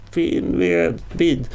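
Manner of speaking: high, drawn-out cry; screamed name. A narrator's reading. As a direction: call the name for rescue. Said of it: "skrek hon igen".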